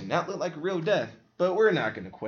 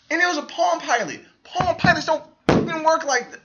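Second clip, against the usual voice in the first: much higher and louder indignant rant; can't believe how dumb it is; exasperated outburst; 7 dB louder than usual. Real, not acted. Ranting about the movie.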